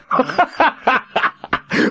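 huge evil laugh echoing; booming belly laugh. A narrator's reading. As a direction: roar with villainous laughter. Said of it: "The belly laugh boomed".

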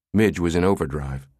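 plain reading voice

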